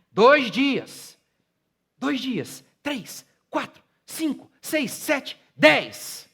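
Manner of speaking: climbing to a shouted, high preacher's cry; preaching at full force. Acted. Pastor testifying to his church, preaching at full force. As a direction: pastor building the testimony into a roaring climax.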